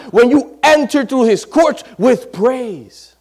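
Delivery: loud, high pulpit preaching; slowed, ringing exhortation; preaching at full force. Acted. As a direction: preach it out with fire.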